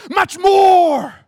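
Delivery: high, booming proclamation; triumphant preacher's shout. Acted. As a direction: shout God's answer to the congregation. Shouts God's answer triumphantly.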